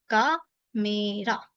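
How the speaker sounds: कामेरा drawn out very slowly, syllable by syllable; कामेरा slowly for learners; slow demo. Shown as a demonstration. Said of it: "it becomes कामेरा". (कामेरा बहुत धीरे)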